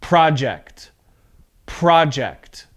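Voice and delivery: careful noun project, stressed beginning; stress on first syllable. Shown as a demonstration. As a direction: project as PRAH-ject, strong start then falling. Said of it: "So you notice my voice here".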